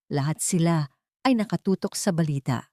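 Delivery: ordinary delivery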